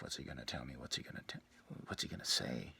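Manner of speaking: a hint of her curious inner voice; woman's inner voice. The woman wondering what he'll say, soft murmur.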